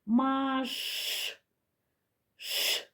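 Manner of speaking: "маш" ending in a long hush, then Ш alone; lingering "маш", then bare hushing "Ш"; picking out next sound. Shown as a demonstration. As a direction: draw out the final Ш of "маш". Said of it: "Наступний звук".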